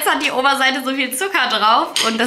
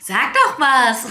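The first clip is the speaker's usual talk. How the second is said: slow, louder, higher mocking echo; 4 semitones higher, 7 dB louder, much slower than usual. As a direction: copy her line back, slow and loud. Friend echoes the request mockingly.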